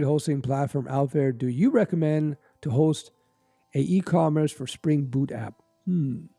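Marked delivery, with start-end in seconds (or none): none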